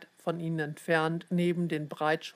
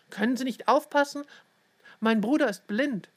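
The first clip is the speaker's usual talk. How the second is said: higher, sharp scolding of a rude stranger; indignant higher scold; 6 semitones higher, 4 dB louder than usual. Acted. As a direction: tell her off for shoving your brother. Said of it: "schimpfte Ted".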